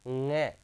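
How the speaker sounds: ng held as a single nga from the nose; nasal pronunciation. Shown as a demonstration. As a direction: model ng slowly as nasal nga. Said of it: "क्या बोलेंगे इसको?". (ng को नाक से "ङ" बोलकर दिखाएँ)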